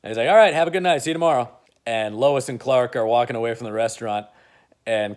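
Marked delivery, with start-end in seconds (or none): 0.3-1.5 louder, higher call after them; calling goodbye. Retold or imitated. Bruce calling after departing Clark, loud. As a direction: shout a breezy farewell.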